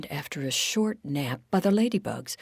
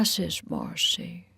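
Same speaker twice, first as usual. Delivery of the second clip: ordinary delivery, nothing marked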